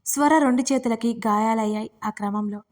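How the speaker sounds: ordinary delivery, nothing marked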